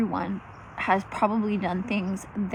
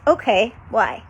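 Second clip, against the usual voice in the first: ordinary talk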